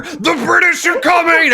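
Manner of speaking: Paul Revere bellowing the alarm; big booming voice. Acted. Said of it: "Fucking big voice".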